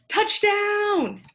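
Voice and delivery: high, loud sports cheer; cheering call. Pretend. Teacher reads word list to pupils, cheering it. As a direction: call it out like a score.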